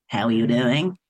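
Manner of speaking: low-pitched, badly faked wrestler accent; Hulk Hogan. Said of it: "What the fuck was that accent?".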